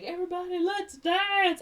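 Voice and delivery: high, calling-out party cry; rally the crowd, high and rising in volume; Ren. Retold or imitated. Ren returns to the party, high mock call to all.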